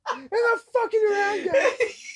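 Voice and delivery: squeaky imitation through laughter; mock high-pitched warning. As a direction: quote the movie, voice cracking up high. Acting out movie scene, laughing.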